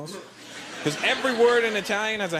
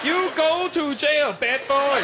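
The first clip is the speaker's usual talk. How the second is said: a slow, higher mock Chinese accent as Confucius; 8 semitones higher, 3 dB louder, slower than usual. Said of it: "Confucius say".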